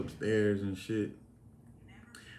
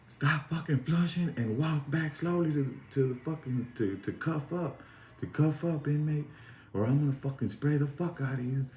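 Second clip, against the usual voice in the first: higher, forceful imitation of a lieutenant; raised-voice cop commands, halting in places; retold threatening command; 6 semitones higher than usual. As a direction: order the inmate back under threat.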